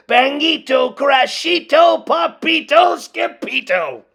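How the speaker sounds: ruckus shout. A narrator's reading. The narrator shouts the ruckus from Skippy John's room. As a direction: bang out each word like a crash.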